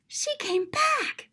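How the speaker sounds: high slow joyful surprise; delighted gasp. Acted. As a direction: gasp with joy at her return. Excited gasp at the girl's return.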